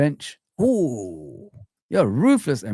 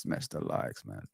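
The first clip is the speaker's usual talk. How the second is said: whispered; 15 dB quieter than usual. Shown as a demonstration.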